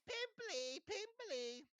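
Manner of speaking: very high jeering imp; yelled taunt. A narrator's reading. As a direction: jeer the nickname in a high yell.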